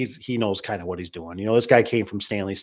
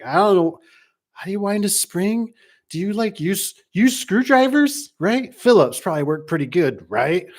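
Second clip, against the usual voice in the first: clueless customer voice; 8 semitones higher, 4 dB louder than usual. Retold or imitated. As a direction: play the clueless owner. Clueless owner asking about springs.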